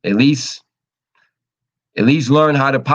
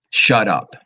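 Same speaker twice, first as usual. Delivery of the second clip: slow emphatic; 4 semitones lower than usual. Real, not acted. Blunt advice punchline.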